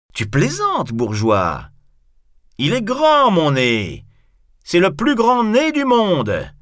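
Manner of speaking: high, loud proud proclamation; outraged, swelling shout; indignant boast. Acted. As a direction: declare it to the whole theatre. Cyrano boasts indignantly of nose.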